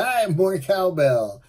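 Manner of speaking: playful mock holler, stretched out; mock calling out. Imitates passers-by calling out to him.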